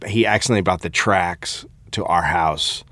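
normal talking voice

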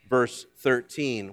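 ordinary talk

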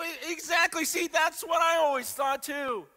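high and loud, eager to agree; emphatic agreement. Retold or imitated. Pastor agreeing with persecuted believers, high excited voice. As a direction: jump in, thrilled to be proven right.

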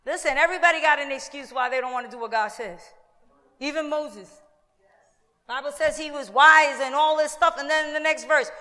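ordinary delivery

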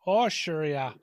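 light mock Minnesota accent. Podcast host echoing Minnesota locals, light Minnesota accent. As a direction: agree like a Minnesotan, lightly put on. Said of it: "that accent is fucking infectious".

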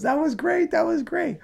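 very high-pitched mock cackling voice; squealed, gleeful retold praise; imitated hysterical glee. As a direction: mimic a bandmate cracking up. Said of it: "he's laughing his ass off".

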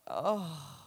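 soft, low, deflated oh; sinking heart. Retold or imitated. Mother hearing the Bible rip, soft sinking sigh. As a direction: let the heart drop out of it. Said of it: "my heart just sunk".

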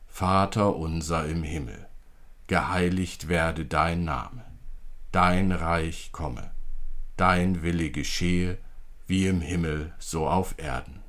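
normal talking voice

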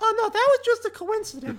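very high, giggling mock modesty; squeaky hero faking humility; coy falsetto. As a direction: play it down in a squeaky voice. Player voices his character coyly.

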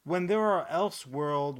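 usual speaking voice

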